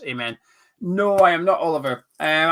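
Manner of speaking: normal talking voice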